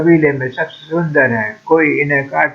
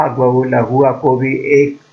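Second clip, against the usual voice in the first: ordinary delivery, nothing marked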